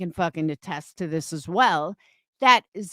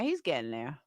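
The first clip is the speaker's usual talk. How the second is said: much lower, much softer repeat; quiet aside to herself; under breath; 7 semitones lower, 12 dB quieter than usual. Real, not acted. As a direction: trail off to yourself.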